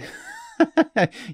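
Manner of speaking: high-pitched burst of laughter; amused laugh. Real, not acted. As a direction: laugh at your own joke. Laughs after joking about pronunciation.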